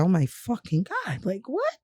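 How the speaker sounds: soft, slow, stunned reaction; stunned disbelief. Retold or imitated. Patient told of miscarriage by phone, hushed disbelief.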